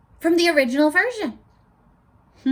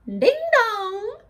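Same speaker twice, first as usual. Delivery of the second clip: sung-out high ding dong; doorbell sound; 9 semitones higher than usual. Retold or imitated. The pig rings the bell, sung high like a chime.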